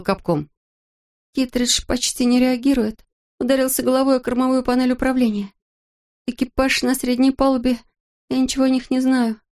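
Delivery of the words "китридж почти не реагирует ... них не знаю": high, tense injured woman's voice; shaken astronaut. A narrator's reading. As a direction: report through shock and pain. Injured astronaut reports by radio.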